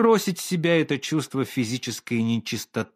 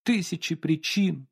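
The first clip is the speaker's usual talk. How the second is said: mild sobbing echo; 5 semitones higher than usual. Acted. Olga repeats the painter's тысячи причин with a light sob. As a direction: echo his тысячи причин through tears. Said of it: "всхлипнула Ольга Ивановна".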